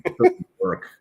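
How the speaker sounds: falsetto attempt at a girl's voice, far too high; bad girl voice. Shown as a demonstration. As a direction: badly fake a high female voice, amused at yourself. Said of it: "I do the girl voices".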